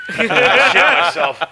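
loud, high, helpless laughing speech; real laughter bursting through words; laughing hard. Real, not acted. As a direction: repeat it while cracking up. Cracking up at own story.

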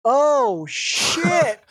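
high startled curse; shocked. Retold or imitated. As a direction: spot the wave and panic. Friend sees wave coming.

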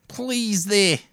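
high, loud Cockney judge; laughing Cockney plea; Cockney accent. Pretend. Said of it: "a Cockney".

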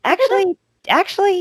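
spring up as the idea wins you over; excited actually. Real, not acted. Host warming to a soap couple, excited and high.

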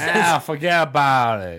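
slow, hammed-up Italian-American wiseguy; mock Italian accent. Friend joining the Vinny bit, mock Italian accent. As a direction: draw it out like a mock Italian mobster. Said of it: "you always wind up resorting to, uh, to Italian".

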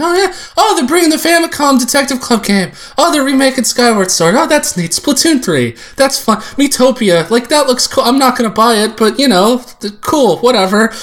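pleased reactions. Retold or imitated. Speaker replays watching the Direct, high mild enthusiasm. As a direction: tick off each item with easy approval. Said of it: "I was like".

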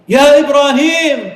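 high, loud, long cry of his name; desperate shout. Acted. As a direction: yell after him with everything she has. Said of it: "so she starts shouting".

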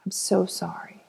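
breathless whisper, stress on so; hushed I'm so sorry; whispered apology. Acted. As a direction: whisper the apology close and soft. Apology to the inner child.

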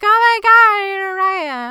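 high, loud mock squeal; imitated gushing revelation; sudden realization. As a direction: burst out in hammed-up excitement.

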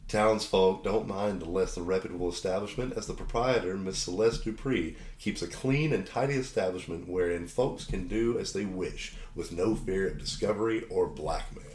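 low, measured scene-setting voice; game master narration. Acted. Game master introduces the saloon town to players, narrating. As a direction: set the stage like a storyteller.

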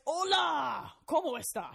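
loud, high, hammed-up Spanish hola; mock Spanish greeting. Podcaster reading a listener's email, hamming up Spanish. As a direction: belt out a cheesy Spanish hello.

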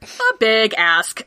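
drawn-out, very slow deadpan; slow understatement. Real, not acted. Riffing on the huge favor, slow and dry. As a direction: stretch it out wryly.

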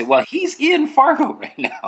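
high, bright retelling of the manager; manager quote. Retold or imitated. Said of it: "my manager was able to say".

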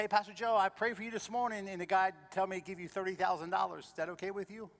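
Charlie's voice. Retold or imitated. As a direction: play the eager friend on the phone. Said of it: "Charlie's Korean".

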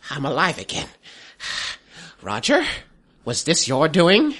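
a hint of a crone waking; old hag. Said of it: "you see the witch digging herself out".

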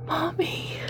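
pleading. Acted. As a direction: beg her mother with a thin, high voice. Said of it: "she pleads".

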